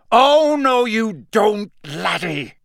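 loud high-pitched roar of outrage. A narrator's reading. Moody catching a student across the entrance hall, roaring. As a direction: bellow it to stop him in his tracks. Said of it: "a roar that echoed".

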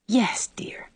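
soft unvoiced whisper. A narrator's reading. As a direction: whisper it tenderly. Anne whispers forgiveness.

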